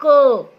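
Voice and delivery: loud teaching demo of क; clear consonant sound. Shown as a demonstration. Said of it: "अब जैसे मैं बोल रही हूं".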